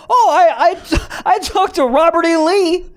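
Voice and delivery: high, loud, laughing reply; hammed-up date answer; mock date answer. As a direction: blurt it out cracking up. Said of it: "Introduce her to my mom?".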